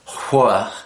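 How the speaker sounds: "Hua" blown out on a breath; breathy "Hua", almost an extra little syllable before the wah. Shown as a demonstration. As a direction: blow the H into "Hua". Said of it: "you blow the Hua".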